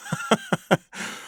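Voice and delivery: a quick smug chuckle; short amused laugh. Acted. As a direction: laugh lightly to yourself. Villain grinning at Lena.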